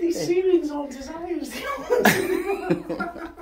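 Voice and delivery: slow and loud, laughing through it; laughing complaint. Real, not acted. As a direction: protest while giggling at yourself. Laughing after head bump.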